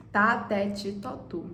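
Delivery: tá, té, ti, tó, tu spelled out one syllable at a time; syllable drill. Shown as a demonstration. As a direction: sound out tá, té, ti, tó, tu slowly for pupils. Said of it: "a gente fala o T".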